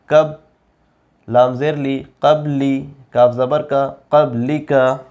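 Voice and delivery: normal talking voice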